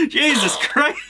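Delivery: high shrieking oh my God; shrieking jackpot winner. Real, not acted. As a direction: yelp in shock and glee. Gecko bites, shrieking.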